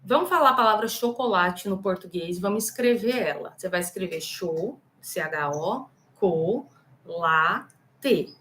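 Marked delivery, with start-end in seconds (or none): 3.6-8.3 "cho, co, la, te" spelled out in separate syllables. Shown as a demonstration. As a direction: sound out each syllable of "chocolate" with a pause between. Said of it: "você vai falar todas as sílabas".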